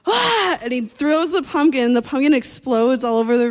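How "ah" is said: high mock yell; high, loud mock scream; imitated scream. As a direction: yell it like the guy with the pumpkin. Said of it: "He's like".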